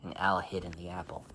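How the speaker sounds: usual speaking voice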